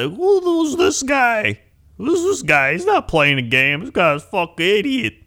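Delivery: high, loud mocking stranger voice; loud, high-pitched heckler impression; heckling chat viewers. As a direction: imitate rude newcomers jeering in a high voice. Acting out hostile newcomers in chat.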